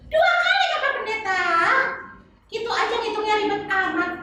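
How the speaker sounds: put-on high, sing-song little girl voice; chirpy kid puppet answering the pastor; high child puppet voice. Tiur counts denials.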